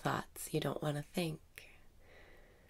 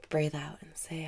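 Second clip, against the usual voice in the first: guided meditation. Acted. Meditation teacher guiding listeners through breathing, calm meditation voice.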